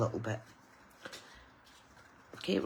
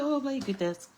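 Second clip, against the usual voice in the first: mock dismay; 9 semitones higher than usual. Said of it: "I was like".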